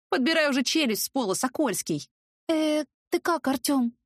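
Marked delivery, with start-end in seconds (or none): 2.5-3.9 high, timid little question; meek bleat. A narrator's reading. Lightly voiced meek wife bleating to her stunned husband. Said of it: "проблеяла кроткой овечкой".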